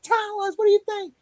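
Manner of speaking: high, squeaky mock prompt; mock questioning. Putting co-host Ryan on the spot, high mock questioning. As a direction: pitch it way up, playfully.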